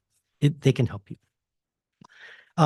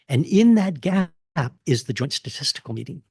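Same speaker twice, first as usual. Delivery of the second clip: ordinary talk, nothing marked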